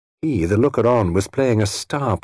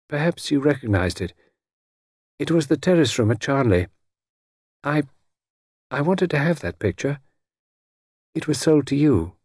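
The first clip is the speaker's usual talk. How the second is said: lightly voiced cool lady, pitched higher; a small, distant voice on the telephone; small cold voice; 6 semitones higher than usual. A narrator's reading. Lady Charnley telephones Satterthwaite, in a small cold voice. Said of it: "small, cold voice that sounded very far away".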